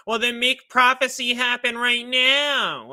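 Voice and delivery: imitating a skeptic. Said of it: "someone was asking me".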